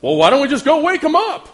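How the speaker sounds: high, loud, naively eager disciple; clueless disciples. Acted. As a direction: chirp a bright idea that misses the point. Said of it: "the brilliant menses that they were".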